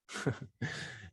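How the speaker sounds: quiet, breath-like laugh; short laugh under the breath; soft chuckle. Real, not acted. Host after greeting Youssef, soft chuckle.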